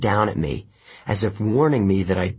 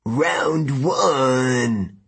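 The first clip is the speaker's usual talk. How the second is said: announcing giant; 4 semitones higher than usual. A narrator's reading. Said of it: "Antaeus announced".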